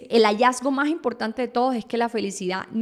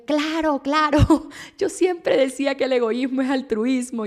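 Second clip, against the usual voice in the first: agudo, entusiasta, con una risa en medio; imitando su emoción; 4 semitones higher, 3 dB louder than usual. Retold or imitated. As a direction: como quien por fin encuentra su idea. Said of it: "Yo dije". ("¡Claro, claro!" emocionada y riendo)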